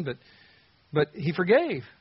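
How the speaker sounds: ordinary talk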